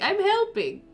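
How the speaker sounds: high, innocent little protest; voicing Dakota. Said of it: "Dakota's like".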